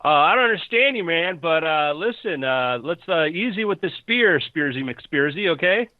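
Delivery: high-pitched bro talking someone down; nervous, appeasing dude from the bushes; wary jungle bro, placating. Acted. Game master plays Steve.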